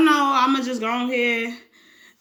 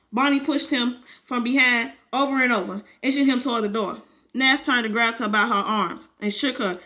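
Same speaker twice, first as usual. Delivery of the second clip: usual speaking voice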